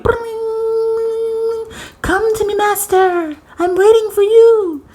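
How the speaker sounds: high, beckoning sing-song voice for the coin; calling coin. Pretend. The coin on the seabed calling its master, high and beckoning. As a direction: lure the master in like a genie coin.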